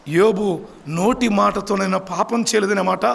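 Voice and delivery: usual speaking voice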